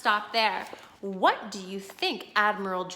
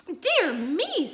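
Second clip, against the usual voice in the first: shocked; 9 semitones higher, 4 dB louder than usual. Acted. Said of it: "in a shocked tone".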